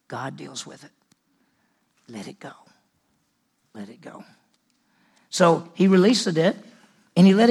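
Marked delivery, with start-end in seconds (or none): 0.1-4.2 soft release. Real, not acted. Preacher urging listeners to let go, soft and hushed. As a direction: breathe it out gently. Gentle closing appeal.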